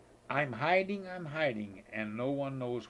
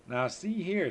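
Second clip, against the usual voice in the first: mother hen; 6 semitones higher, 4 dB louder than usual. Acted. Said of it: "said the mother".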